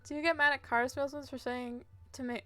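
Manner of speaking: usual speaking voice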